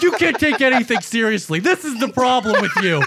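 mock exasperated brother; retold angry yell. Brother fed up with the laughing, retold angry yell. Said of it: "you called to yell at me".

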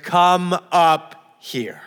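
slow, rumbling call from above; rumbling voice of the Lord. Acted. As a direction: call it out like a rumble over the earth. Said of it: "rumbles across the earth".

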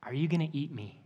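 soft, lightly voiced little boy; a hint of a small boy, spoken quietly. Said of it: "in his little boy voice".

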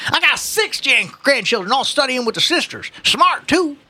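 put-on high-pitched boasting old-timer; old man. Old man boasting of his grandchildren, proud. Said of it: "the old man said".

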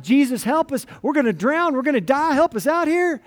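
high, loud panicked cry for help; voicing frightened disciples in a storm, loud and high. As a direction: shout it like the boat is sinking. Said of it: "they're all kind of freaking out".

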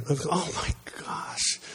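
slow, hushed "oh my gosh"; breath-only aside of mock dismay; whispered dismay. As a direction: whisper it to yourself, drawn out. Dismay at own inner thoughts.

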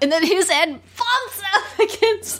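high, breaking into laughter; real giggles overtaking the words; cracking up. Real, not acted. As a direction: try to finish while starting to laugh. Cracking up retelling the gag.

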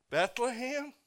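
high, rising "Bethlehem?" in disbelief; incredulous. Pretend. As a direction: ask it as if it's absurd. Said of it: "incredulous repeat of the town".

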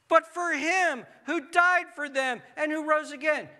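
loud, high, slow proclamation of the verse; fervent scripture proclaim. Acted. Preacher proclaiming Paul's words to the congregation, loud and fervent. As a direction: ring it out like a preacher at full force. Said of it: "Paul says this".

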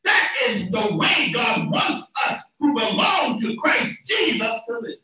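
forceful, emphatic scripture preaching. Real, not acted. Preacher quoting scripture to the congregation, preaching.

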